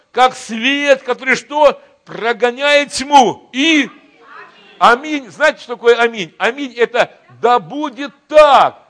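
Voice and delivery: loud, high, fiery sermon voice; preaching at full force. Acted. Pastor rallying his church as God's army, preaching loud. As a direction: rouse the church like a revival preacher.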